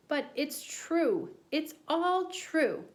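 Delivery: high, slow pleading; high drawn-out kid insistence; slow insistent boy. Acted. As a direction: plead each word slowly.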